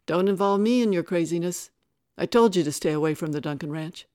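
loud, firm disowning retort; angry rebuke. A narrator's reading. Father at the back of the crowd to Tara, angry rebuke. Father loudly rejects Tara's appeal.

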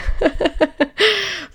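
high embarrassed giggle; light laugh. Real, not acted. Embarrassed at own mistake.

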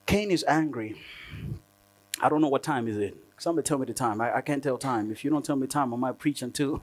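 ordinary talk, nothing marked

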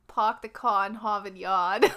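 poking fun at Boston, r's dropped; exaggerated Bostonian accent, laughing at the end; broad Boston accent, open "ah" vowels; mock Boston accent. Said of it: "Bostonian. My mom always used to make fun".

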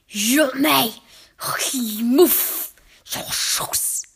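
loud, slow barked command; guttural witch with a long rolled R; Grand High Witch. A narrator's reading. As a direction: bark it harshly, dragging out each word. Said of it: "barked the Grand High Witch".

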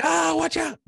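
high, loud panicked yelp; mock panic. Imitating how a panicked friend would have yelled. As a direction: squeal it like you're freaking out. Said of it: "not be like".

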